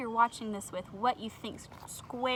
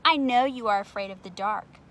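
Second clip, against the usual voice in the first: gloating; 12 dB louder than usual. Acted. Square gloating back.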